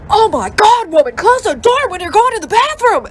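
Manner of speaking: high, loud mock-husband shout; outraged yelling. Husband to wife with bathroom door open, outraged yelling. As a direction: yell it in hammed-up outrage.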